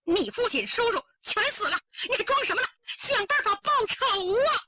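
climbing to a high, anguished demand; distraught outburst. Acted. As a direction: plead furiously for revenge, rising in pitch. Bereaved woman confronts her sister. (悲愤激动，越说越高)

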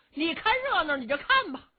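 high, loud huffy retort; exasperated. A narrator's reading. Strategist gives up on his mocking comrade, exasperated. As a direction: snap back in a huff. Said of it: "徐茂公气坏了".

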